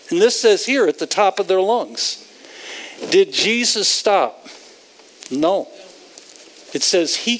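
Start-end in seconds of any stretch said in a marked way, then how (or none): none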